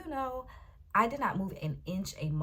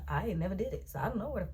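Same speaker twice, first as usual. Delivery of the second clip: ordinary delivery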